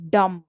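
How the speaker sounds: dumb said short and loud as a stressed beat; stressed syllable sound. Shown as a demonstration. Teacher demonstrating a stressed beat, loud and short. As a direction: punch dumb hard like a drumbeat. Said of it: "you can have it as".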